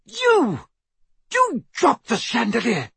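very high, aghast accusation; outraged cry. A narrator's reading. Outraged woman accuses the elf.

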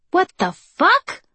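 high, drawn-out shocked cry; shocked outburst. A narrator's reading. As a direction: blurt it out in disbelief. I blurt into his bed.

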